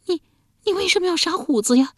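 wife pleading with a hand over her mouth; tearful. A narrator's reading. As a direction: speak through his fingers on the edge of tears. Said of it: "且带着哭腔".

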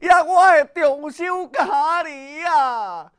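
a slow, loud, high cry; a father crying out loudly to his lost son. A narrator's reading. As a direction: wail out the son's name as he calls. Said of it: "失声的叫说".

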